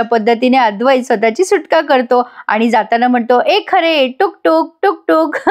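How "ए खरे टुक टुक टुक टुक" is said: higher-pitched teasing sing-out; playful taunt. Retold or imitated. Husband escapes past his tricked wife, teasing her playfully. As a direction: tease like someone who just got away.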